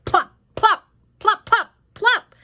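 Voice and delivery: high, loud plopping; plop sounds. Acted. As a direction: pop each plop out. Sound effect reading.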